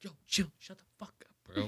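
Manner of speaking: hissed, voiceless aside to hush him; mock whisper. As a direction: whisper it like a panicked publicist. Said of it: "his fucking publicist is like".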